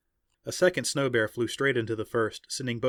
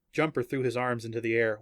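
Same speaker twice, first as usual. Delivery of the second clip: usual speaking voice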